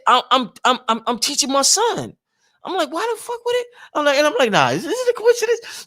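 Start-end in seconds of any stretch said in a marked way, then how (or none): 2.9-3.7 very high, incredulous retold thought; mock disbelief, pitched way up; inner thought. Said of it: "this is what I'm saying in my mind". 4.5-5.9 very high-pitched retold doubt; mock-incredulous squeak; inner thought. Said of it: "this is what I'm saying in my mind".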